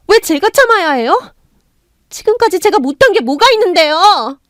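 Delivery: daughter-in-law talks back to her mother-in-law, building; defiant talking back. Acted. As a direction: stand up to her, building to a shout. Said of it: "하고 대들었습니다".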